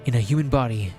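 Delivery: usual speaking voice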